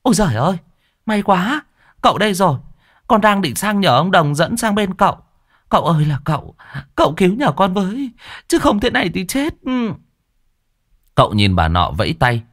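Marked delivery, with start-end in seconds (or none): 0.0-9.9 much higher, desperate middle-aged woman; wailing woman. Acted. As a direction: cry out for rescue, high and pleading. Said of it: "bà ấy hú lên".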